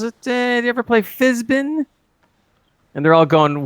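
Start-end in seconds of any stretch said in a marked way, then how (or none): 0.0-1.9 a raised, sly conman pitch; lightly voiced Kirk selling Fizbin. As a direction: hook them with a made-up game.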